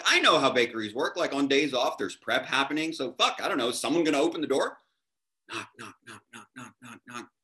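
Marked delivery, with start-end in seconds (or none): 5.5-7.3 very soft, even, repeated knocks; hushed, rhythmic knock sound effect; imitated knocking. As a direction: tap out the words quietly like knuckles on a door. Imitates knocking on the bakery door.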